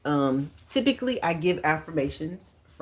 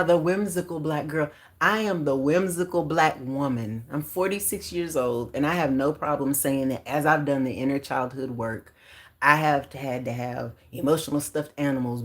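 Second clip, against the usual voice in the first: usual speaking voice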